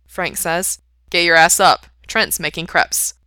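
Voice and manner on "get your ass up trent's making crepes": much louder, brisk command; loud rousing order. A narrator's reading. Friend waking his bandmate, loud. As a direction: order a sleeper out of bed.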